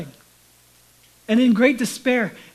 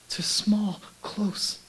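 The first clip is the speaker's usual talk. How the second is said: hushed, intimate whisper; 2 semitones lower, 7 dB quieter, much slower than usual. Real, not acted. Pastor drawing the congregation close in a whisper. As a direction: lean in and whisper it.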